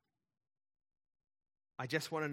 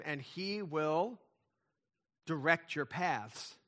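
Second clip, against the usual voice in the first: slow, drawn-out recitation; slow scripture close; much slower than usual. Acted. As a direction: stretch out the last words of the verse, slowly. Finishes quoting King James verse.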